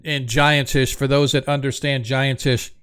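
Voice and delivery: ordinary delivery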